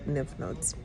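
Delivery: ordinary talk, nothing marked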